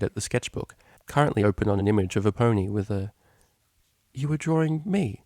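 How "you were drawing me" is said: surprised realization. Acted. Octavia voiced, timbre changed.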